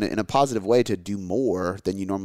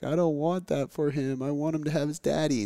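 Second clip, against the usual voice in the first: imitating upset daughter; 7 semitones higher, 4 dB quieter than usual. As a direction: plead like a little girl near tears, pitched up. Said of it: "my daughter starts crying".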